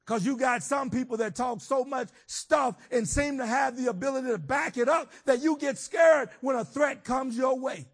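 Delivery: loud, high preaching at full force; fiery preaching. Acted. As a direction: preach it out, building to a shout.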